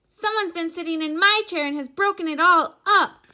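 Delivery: high, very loud little squeak; Baby Bear. A narrator's reading. Baby Bear finds his broken chair, squeaking loudly. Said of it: "squeaked in his wee little voice".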